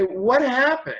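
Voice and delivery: high-pitched imitation of bewildered children; children's questions. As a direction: lightly voice a child asking, pitch climbing. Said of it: "talk to them".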